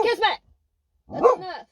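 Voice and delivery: firm, loud call of the dog's name; firm dog command; sharp call. Shown as a demonstration. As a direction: snap her name to stop the barking. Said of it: "See the difference?".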